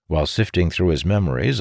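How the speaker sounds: ordinary delivery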